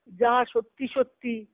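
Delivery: ordinary talk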